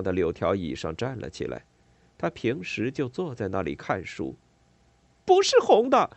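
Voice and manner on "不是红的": high, loud cry through angry tears; tearful angry shout. A narrator's reading. Boy shouting at his mother through angry tears. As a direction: shout it out, fighting back tears. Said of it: "我咽下愤怒的泪水喊道".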